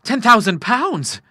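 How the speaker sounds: slow, high astonished exclamation; amazed cry. A narrator's reading. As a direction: draw it out in wonder. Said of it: "he cried".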